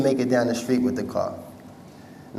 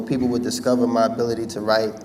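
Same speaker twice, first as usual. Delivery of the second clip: ordinary talk, nothing marked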